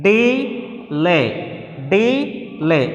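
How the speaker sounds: "delay" split into two slow, separate syllables; teacher sounding out "delay" in two pieces. Shown as a demonstration. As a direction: stretch both halves of "delay", pausing between. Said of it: "In that we have two syllables".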